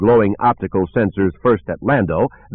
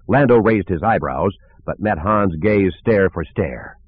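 ordinary delivery

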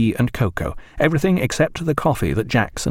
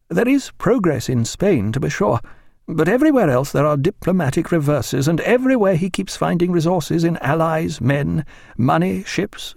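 higher-pitched, measured official's voice; grave Admiralty official; 7 semitones higher than usual. Acted. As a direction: deliver a worried, bleak intelligence briefing. Official gives bleak report in dialogue.

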